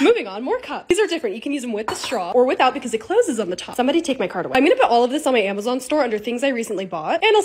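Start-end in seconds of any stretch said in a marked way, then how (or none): none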